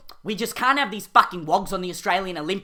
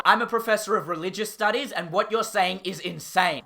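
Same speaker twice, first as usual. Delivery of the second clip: ordinary talk, nothing marked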